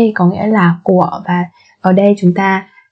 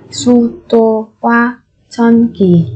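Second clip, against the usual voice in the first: syllable by syllable. Shown as a demonstration. Teacher models word syllable by syllable.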